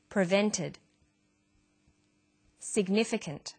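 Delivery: Tremendous, Averted, Prevented, Significant, Vitamin, Deficiency each said alone with its stress clear; teacher drilling single words; isolated word stress. Shown as a demonstration. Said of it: "which syllable is stressed".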